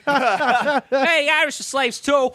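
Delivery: high, laughing Boston yell; hammed-up Boston accent through laughter; mock Boston brawler, laughing. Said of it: "Except it's in a Boston accent".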